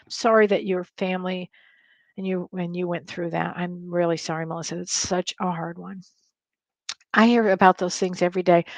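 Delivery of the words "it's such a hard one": ordinary talk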